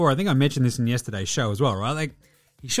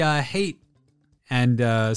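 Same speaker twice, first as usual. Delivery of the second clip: usual speaking voice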